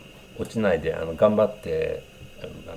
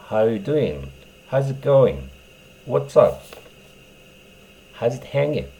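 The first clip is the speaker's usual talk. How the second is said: very slow, drawn-out list of greetings; slow recap drill; 5 semitones higher, 3 dB louder, much slower than usual. Shown as a demonstration. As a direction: spell each greeting out slowly for learners. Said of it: "うまく使い分けてくださいね". (一つずつゆっくり言い聞かせるように)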